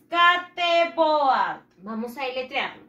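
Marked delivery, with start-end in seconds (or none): 0.1-1.6 SKATEBOARD sounded out letter by letter as Spanish, "es-ka-te-bo-ard", slow and loud; spelled-out Spanish reading. Shown as a demonstration. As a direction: stretch SKATEBOARD into Spanish syllables with every vowel sounded. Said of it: "Se escribe así".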